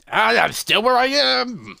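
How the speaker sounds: high, loud cry of frustration; exasperated outburst. Acted. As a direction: cry out, voice leaping up. Character despairs at being stuck.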